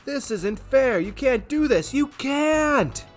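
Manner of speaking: high, loud outraged shout; angry protest. Acted. Harvey shouting at the house in outrage, high loud protest. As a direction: rage at the unfairness.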